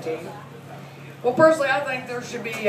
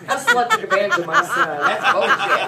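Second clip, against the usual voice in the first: higher, indignant tirade with a laugh; mock outrage; 9 semitones higher, 3 dB louder than usual. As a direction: rant like an offended mother. Mother blaming the girl.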